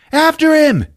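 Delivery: high, loud cartoon yell; mock shout. Guard shouting after the spy, high and loud. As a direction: yell the order like a panicky guard.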